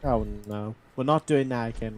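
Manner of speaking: usual speaking voice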